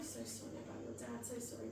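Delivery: put-on greeting kids whose dad died, very soft; hushed condolence. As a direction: say it gently as if to grieving children. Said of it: "kids came in".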